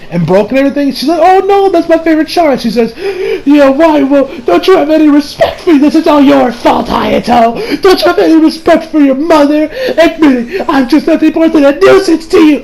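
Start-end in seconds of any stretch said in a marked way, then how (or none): 1.2-2.6 high-pitched mock mother's lament; dismayed mom. Mom upset over broken china. 3.5-12.6 high, hammed-up tearful scolding; mom starting to cry. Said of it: "she's, like, legit starting to cry".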